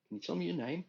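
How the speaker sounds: a rising, insistent question; doctor questioning. Acted. Doctor questioning patient again.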